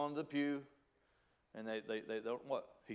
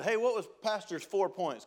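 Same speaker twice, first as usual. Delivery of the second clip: lightly acted casual question, higher pitch; pitched-up mock inquiry; curious lunch companion; 6 semitones higher, 4 dB louder than usual. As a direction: ask it like someone quizzing friends at lunch. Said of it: "if somebody said".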